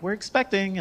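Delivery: high, lilting news-breaking voice; imitated happy announcement. Friends telling him about a baby, high excited announcement.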